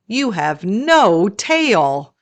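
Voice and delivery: ordinary delivery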